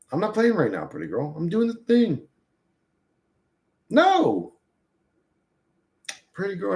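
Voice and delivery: high playful refusal to the dog; high sing-song refusal to the dog; high pet voice. Real, not acted. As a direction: tease the dog, no play now. Dog wants to play, refusing.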